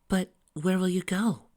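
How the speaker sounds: put-on high, loud worried young woman; pleading girl voice. A narrator's reading. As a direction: plead with him. Sally upset, high.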